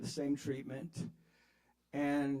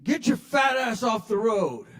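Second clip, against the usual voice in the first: higher and louder, a trucker's yell; mock yell; 7 semitones higher, 8 dB louder than usual. Pickup driver yelling at the cyclist, mock loud yell. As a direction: shout it like a road-raging driver. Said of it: "pulls up and says".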